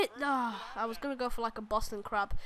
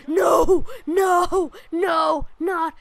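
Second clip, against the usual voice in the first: loud, higher yells of no; shouting no; 5 semitones higher, 8 dB louder, much slower than usual. Real, not acted. Player losing the match, yelling no in panic. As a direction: yell no at the screen in panic.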